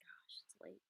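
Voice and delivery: breathy late-night whisper. Real, not acted. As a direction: whisper it to yourself. Late night stream aside.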